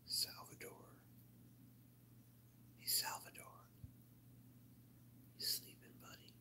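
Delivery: soft hushed call; whispered. Real, not acted. Checking if his friend Salvador is asleep, whispered. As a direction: whisper so you don't wake him.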